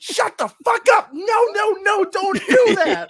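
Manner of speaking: put-on panic-scold your kid; mad dad hushing. Father hushing his daughter in Walmart, high frantic scold. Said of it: "I was so mad".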